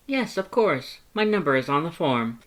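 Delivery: high, bright-toned loud thanks; eager to help; cheerful Papyrus. Acted. Papyrus matter of fact.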